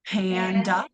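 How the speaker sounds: panda, PAN strong, a weak uh at the end; stress on first syllable. Shown as a demonstration. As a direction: repeat panda as a model. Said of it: "stressed syllable".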